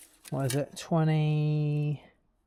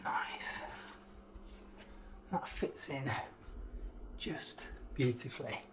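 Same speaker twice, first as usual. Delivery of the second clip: whispered; 13 dB quieter, slower than usual. Real, not acted. Quiet satisfied remark.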